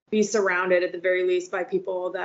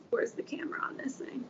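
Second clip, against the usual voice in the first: whispering; 4 semitones higher, 11 dB quieter than usual. Real, not acted. Fumbling with camera, muttering.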